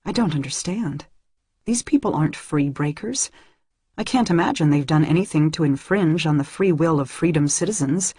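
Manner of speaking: plain reading voice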